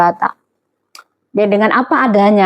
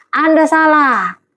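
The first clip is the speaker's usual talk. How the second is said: ANDA SALAH higher and drawn out; emphatic rebuttal; 5 semitones higher, slower than usual. Real, not acted. Preacher correcting the congregation's assumption, emphatic ANDA SALAH.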